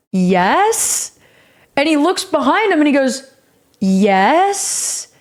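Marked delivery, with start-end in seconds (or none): 0.1-1.2 low, drawn-out yes gliding up; imitating a tentative reply; hesitant questioning yes. Wary reply to being recognized. 3.8-5.1 low, drawn-out yes gliding up; imitating a tentative reply; hesitant questioning yes. Retold reply, same questioning yes.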